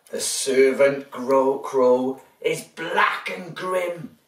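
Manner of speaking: lively storybook reading; reading to children. A narrator's reading. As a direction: read it big for little ones.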